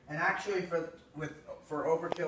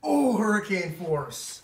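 excited slow call; 5 semitones higher, 9 dB louder, slower than usual. Real, not acted. Announcing the race winner.

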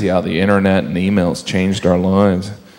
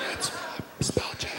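breathy whispered aside; 19 dB quieter than usual. Acted. An aside whispered to the audience. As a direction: whisper it like a secret.

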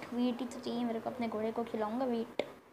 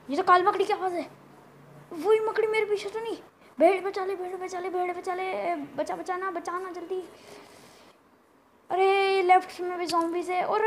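high-pitched, frantic calls for help; urgent alarm; 5 semitones higher, 4 dB louder than usual. Real, not acted. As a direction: plead urgently as the monsters close in. Hears spider, begs for rescue. (डर के मारे ऊँची आवाज़ में)

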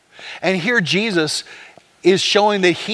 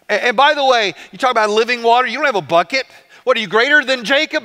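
pushback with pitch raised; talking smack; 4 semitones higher, faster than usual. Acted. Samaritan woman doubting Jesus's living water, talking smack. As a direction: challenge him, callous and higher-pitched. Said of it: "she's talking smack".

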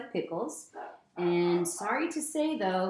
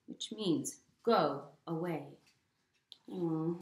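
plain reading voice

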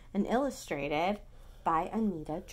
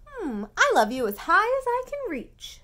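higher, loud eager bunny; excited little bunny; 11 semitones higher, 10 dB louder than usual. Acted. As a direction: reach up high with a small voice. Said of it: "said Little Nut Brown Hair".

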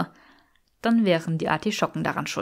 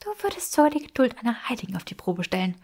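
leicht höher und schnell, durch die Zähne gepresst; mild schnaubend; 4 semitones higher, faster than usual. A narrator's reading. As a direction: verhaltener Ärger durch zusammengebissene Zähne. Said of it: "schnaubte Granger mit zusammengebissenen Zähnen". (gereizt zischend, schnell gesprochen)

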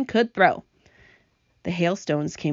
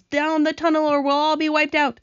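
higher, urgent warning; urgent cry; 8 semitones higher than usual. A narrator's reading. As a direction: order everyone to safety. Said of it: "cried James".

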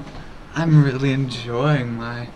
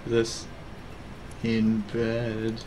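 low, soft sultry mistress voice; lightly played sultry streamer, hushed and deep; 6 semitones lower, 10 dB quieter, much slower than usual. Streamer playing a donated sultry role, sultry. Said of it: "back to the sultry mistress".

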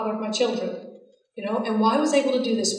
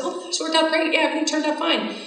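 higher, breezy, offhand mock answer; breezy reply; 6 semitones higher than usual. Answering a friend on the phone, a higher, breezy offhand tone. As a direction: brush it off like it was nothing. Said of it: "And I said".

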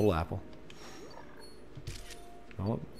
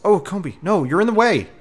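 high, loud protest at a game creature; loud, high-pitched frustrated cry; exasperated shout; 10 semitones higher, 10 dB louder than usual. Real, not acted. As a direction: yell at it for blocking you. Combee blocks the player's shot.

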